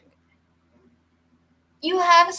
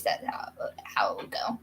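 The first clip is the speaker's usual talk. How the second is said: whispered, dead serious; 14 dB quieter than usual. Real, not acted. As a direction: drop to a whisper to say it. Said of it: "being dead serious".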